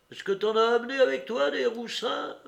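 high, loud jeering question; taunting question. A narrator's reading. Woman asks Courrapier about his policemen, taunting. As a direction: needle him, pitched right up.

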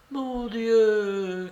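put-on high-pitched dismayed old lady; crying out in dismay. A narrator's reading. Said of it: "cria-t-elle". (exclamation consternée, voix aiguë)

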